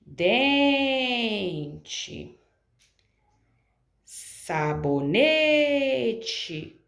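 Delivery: Dente with DEN stretched, sabonete with NE held long and very slow; prolonged stressed syllable. Shown as a demonstration. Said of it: "Qual sílaba que eu prolonguei?".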